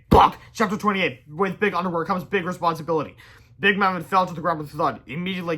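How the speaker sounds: ordinary delivery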